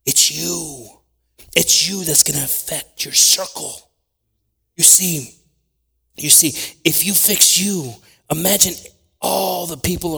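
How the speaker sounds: emphatic preaching. Real, not acted. Preaching climax.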